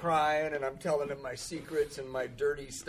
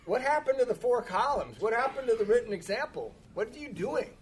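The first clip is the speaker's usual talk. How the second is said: higher-pitched, incredulous demand; exasperated scolding; 8 semitones higher, 4 dB louder than usual. Retold or imitated. Sponsor challenging his sponsee on the missed assignment, exasperated. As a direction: take the pupil to task, voice pitched up.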